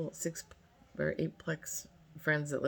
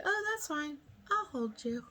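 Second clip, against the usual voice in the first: high, soft retold coaxing; mock reassurance; 10 semitones higher, 3 dB quieter than usual. Pool guy reassures a scaredy cat, imitated high and soft. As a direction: lift it high and light, imitating him.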